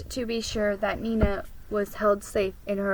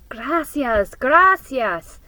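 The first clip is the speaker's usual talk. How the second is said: grateful thanks; 4 semitones higher, 7 dB louder, slower than usual. Acted. Grateful old man thanks women.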